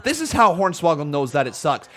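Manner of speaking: ordinary talk, nothing marked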